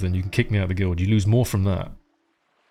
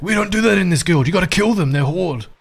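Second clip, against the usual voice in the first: high German smoker voice, scolding; Gilnash; 8 semitones higher than usual. Acted. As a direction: scold in a pitched-up German accent. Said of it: "in his German smoker voice".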